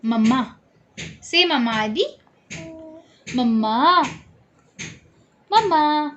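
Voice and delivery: high sing-song coaxing. Real, not acted. Coaxing baby to say mamma. (बच्चे को मम्मा बोलने के लिए मनाना)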